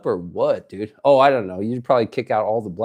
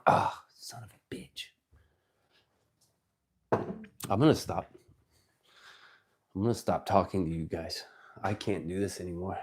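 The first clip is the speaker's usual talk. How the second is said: much softer, lower, mumbling in shame; embarrassed mutter; 4 semitones lower, 8 dB quieter than usual. Real, not acted. Streamer embarrassed on camera, soft ashamed mutter. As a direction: mutter it like you want to hide.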